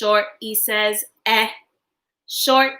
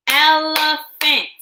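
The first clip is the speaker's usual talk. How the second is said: broken into syllables; 3 semitones higher, slower than usual. Shown as a demonstration. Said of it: "Break words in two parts with me".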